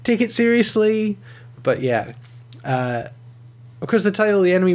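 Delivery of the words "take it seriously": higher-pitched mock yell, no real volume; mock screaming. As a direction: plead at the TV in a mild, raised-pitch scream. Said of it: "screaming at the T V like".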